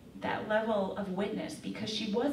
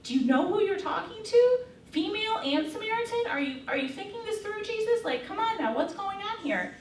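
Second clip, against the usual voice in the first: much higher, incredulous mock outburst; freaking out; 12 semitones higher, 5 dB louder than usual. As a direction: play a scandalized disciple confronting Jesus in a hammed-up panic. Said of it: "they're freaking out, like".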